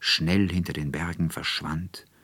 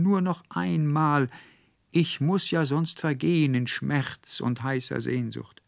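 longing plea; 8 semitones higher than usual. Acted. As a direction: plead with aching longing. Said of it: "rief unaufhörlich in die Zweige und Blätter hinein".

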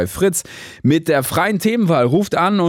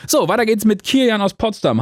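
ordinary talk

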